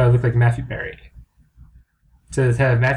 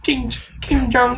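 high mock caricature; 10 semitones higher than usual.

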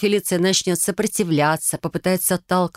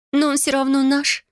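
higher-pitched protest; objecting; 9 semitones higher than usual. A narrator's reading. Sister objecting that he is still their father, insistent. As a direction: push back, voice raised in pitch. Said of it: "возразила Фелиция".

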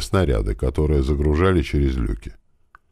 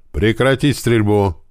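slow, higher-pitched order to cease fire; raised, measured commanding call; shouted command; 5 semitones higher, 4 dB louder, slower than usual. A narrator's reading. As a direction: call out a firm, drawn-out command. Officer orders ceasefire during shooting.

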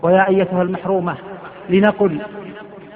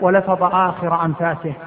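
normal talking voice

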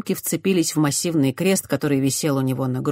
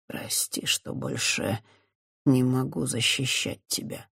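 whisper; 3 semitones lower, 6 dB quieter than usual. A narrator's reading. Priest whispers again.

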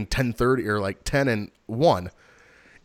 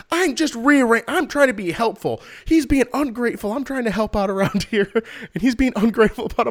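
high, whiny imitation of mom; high put-upon mom voice; mom's whiny protest; 12 semitones higher, 5 dB louder than usual. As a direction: mimic mom's wounded complaint, pitched up. Mom voiced in retold quarrel.

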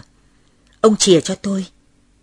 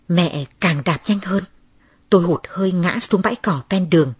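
ordinary delivery, nothing marked

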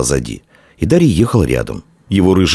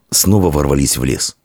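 usual speaking voice

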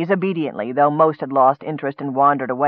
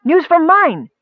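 very high, loud announcement; insistent; 11 semitones higher, 5 dB louder, slower than usual. A narrator's reading. Miner repeating his news to the leader, insistent. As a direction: push the news on him urgently. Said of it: "the red dripping miner insisted".

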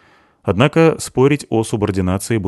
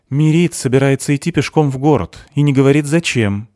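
mildly plaintive, higher pitch; complaining; 7 semitones higher, 4 dB louder than usual. A narrator's reading. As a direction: tattle with a touch of grievance. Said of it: "пожаловалась Криспи". (слегка жалуясь)

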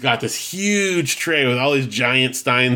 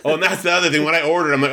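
usual speaking voice

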